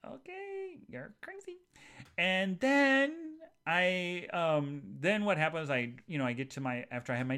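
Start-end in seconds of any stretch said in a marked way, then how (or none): 0.1-1.6 high, soft sarcastic okay; mock dismissive. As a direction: humor her in a high soft voice. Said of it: "And I'm like".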